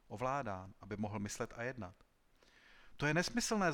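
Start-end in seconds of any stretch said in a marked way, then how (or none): 3.0-3.7 high, loud exclamation of disbelief; indignant outcry. A narrator's reading. Inventor rejecting his critic's verdict, crying out. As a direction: cry out against an absurd idea. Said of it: "zvolal Korin".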